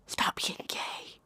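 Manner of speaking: slow, hushed whisper; mock whisper. Mocking a preachy bigot, slow whisper. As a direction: whisper it like a snide secret.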